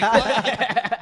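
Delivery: loud, high laughing "What?"; shocked laugh. Retold or imitated. Telling the boys about the manager, loud laughing shock. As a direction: burst out laughing in disbelief.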